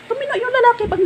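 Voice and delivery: high, laughing as she tells it. Real, not acted. Telling viewers the man reformed, laughing high. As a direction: giggle through the line.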